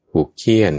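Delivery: ordinary talk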